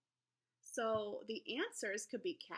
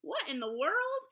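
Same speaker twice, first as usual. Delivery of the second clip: louder, higher mock amazement; 5 semitones higher, 7 dB louder than usual. Teacher reading a quiz heading, hammed-up surprise. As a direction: read it like a big puzzled exclamation. Reading a section title with flair.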